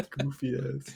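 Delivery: said through laughter; laughing. Real, not acted. Laughing mock.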